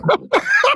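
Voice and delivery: sudden shrieking laugh; burst of laughter. Real, not acted. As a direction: crack up in disbelief. Host bursts out laughing at reply.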